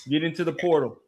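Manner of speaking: ordinary talk, nothing marked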